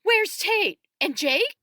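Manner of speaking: high, loud, urgent demand; panicked, pitch shooting up; frantic questioning. A narrator's reading. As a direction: fire off alarmed questions. Frantic mother spins round asking.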